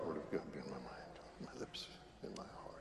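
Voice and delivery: quick murmured prayer under the breath; hushed, rushed private prayer; whispered prayer. Real, not acted. Murmured prayer before gospel.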